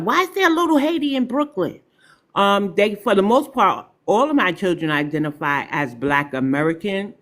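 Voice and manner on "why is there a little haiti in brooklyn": put-on high, quick young boy's voice, a curious kid's question; imitating her son. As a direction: pipe it up fast like her young son asking. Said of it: "my son came home the other day, said".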